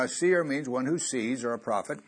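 ordinary talk, nothing marked

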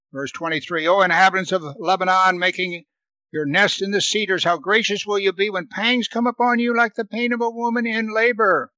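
preacher reading scripture aloud. Acted. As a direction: read the passage out to the congregation.

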